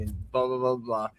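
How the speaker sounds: usual speaking voice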